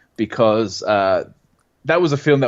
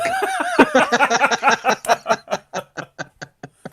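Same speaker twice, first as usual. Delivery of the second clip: long, very high laughing fit; helpless high-pitched cackle. Real, not acted. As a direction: lose it laughing. Cracking up at the impersonation.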